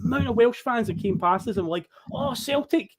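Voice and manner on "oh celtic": retold fans' high-pitched recognition; excited fan greeting. Welsh fans spot his Celtic shirt, excited.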